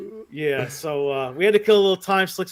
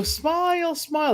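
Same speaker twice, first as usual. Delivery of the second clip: put-on high sing-song "smile, smile" coaxing a baby; coaxing parents; 10 semitones higher, slower than usual. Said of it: "They go".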